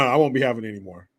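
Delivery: ordinary talk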